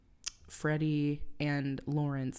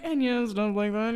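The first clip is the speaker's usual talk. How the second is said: higher imitation fading out; trailing off; 6 semitones higher, 4 dB louder than usual. Cohost imitating Dewey trailing off, mock voice. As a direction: let the words drift away. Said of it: "He's just, like, trailing off".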